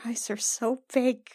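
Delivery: ordinary talk, nothing marked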